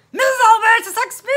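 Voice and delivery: loud, high questioning shout; loud officer querying the name; incredulous policeman. Acted. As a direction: boom the name back. Policeman repeats name loudly.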